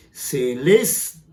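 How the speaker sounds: "celeste" with -les- punched, -te split off; teacher's drawn-out "celeste", beat on -les-; stress on penultimate syllable. Shown as a demonstration. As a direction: hit the middle of "celeste": ce-LES... te. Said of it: "¿Ve el golpe?".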